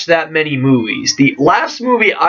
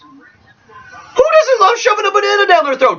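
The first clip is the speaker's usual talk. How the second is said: mock shout; 13 semitones higher than usual. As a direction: belt out the banana joke. Joking about bananas.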